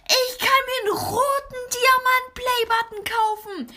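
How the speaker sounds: high, shouted hype; over-the-top excited high voice. Acted. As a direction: boast in a squealing high voice. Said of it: "mit einer höheren Stimme geredet".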